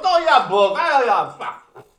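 put-on loud, rowdy Australian bloke accent, high and shouted. Teasing Australian fans with a loud mock Australian accent. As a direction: bellow it like a boisterous Aussie mate in an Australian accent. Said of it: "this is bracketed in an Australian accent".

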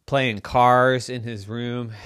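ordinary delivery, nothing marked

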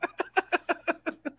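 a high laugh cut into a squeal. Real, not acted. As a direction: laugh off the teasing. Laughs at the correction.

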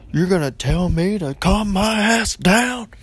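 slow, high, loud mock yell; outraged mom. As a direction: imitate a mother blowing up, stretching each word. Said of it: "She went from crying to".

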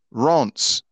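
Reims said as "ronts"; French pronunciation. Shown as a demonstration. Host baffled by the real name, Reims as "ronts". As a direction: give Reims one syllable, "ronce" with a nasal vowel. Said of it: "It's actually pronounced".

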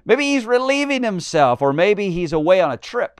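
high-pitched, loud ridicule; scoffing. Acted. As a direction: taunt them, starting high and easing down. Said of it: "And he scoffed".

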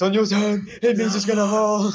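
alarmed as the tower sways; tense warning. Real, not acted. Jenga tower wobbling.

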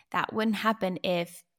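ordinary delivery

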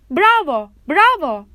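loud, high cheers of bravo; cheering. A narrator's reading. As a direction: cheer like a whole court. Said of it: "diye bağırdı".

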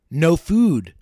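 loud, emphatic plea for food; desperate plea. A narrator's reading. Hungry ghost answers the investigator, desperate. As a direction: read the hungry ghost's protest with force. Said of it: "was panting in desperation".

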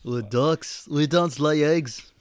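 slow mock-British child voice; put-on British accent, stretched words; little British girl. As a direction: imitate a British child, drawing it out. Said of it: "It's British".

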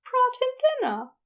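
smiling as she teases; with a grin. A narrator's reading. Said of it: "she said with a grin".

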